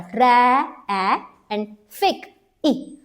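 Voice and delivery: ट्रै and फिक broken apart slowly, vowel named after each; syllable split. Shown as a demonstration. Teacher splitting traffic into syllables for students. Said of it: "टू sound आ रहा है vowels का".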